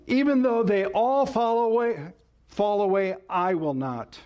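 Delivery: high, insistent boast, settling at the end; acted disciple's proud pledge, starting high; boastful vow. Peter vowing loyalty to Jesus, boastful. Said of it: "He boasts that he will give his life".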